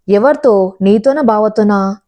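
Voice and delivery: usual speaking voice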